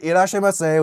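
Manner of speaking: lightly imitated shop clerk's formal greeting; a hint of a formal Japanese clerk. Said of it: "they're all, like, formal and shit".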